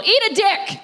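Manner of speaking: high mock yell at the dog; cheerful hammed-up shout while throwing a toy. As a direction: fling it out like a playful shout. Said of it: "I just yell".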